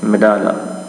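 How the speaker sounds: मेडाला said as me-da-la, open middle a; the correct मेडाला; correct pronunciation. Shown as a demonstration. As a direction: have students read मेडाला this way. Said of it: "इसको आपने पढ़ना है मेडाला".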